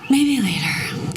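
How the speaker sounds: slow breathy guru take on maybe later; hammed-up slow mystic echo; slow, drawn-out mock mystic. Shown as a demonstration. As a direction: stretch the words like a mock sage. Repeats slowly, mock-mystical.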